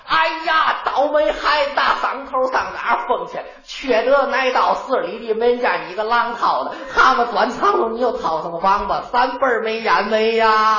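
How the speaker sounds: loud scolding Tianjin granny calling down the alley; old lady yelling. Acted. Tianjin granny yelling for her runaway grandchild, loud and sharp. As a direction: scold the runaway kid at full voice, Tianjin style. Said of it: "这一嗓子能喊出二里地".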